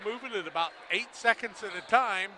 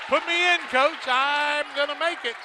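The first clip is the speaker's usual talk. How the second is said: loud, high cocky shout, playing the player; mock player shout; 6 semitones higher, 8 dB louder than usual. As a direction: shout it like the eager bench player begging the coach. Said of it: "Edwards says".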